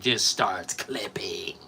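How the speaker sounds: slow hushed whisper; eerie whisper. Pretend. Telling chat about the killer, slow creepy whisper. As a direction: breathe it out like a horror trailer. Describing slasher killer's attack.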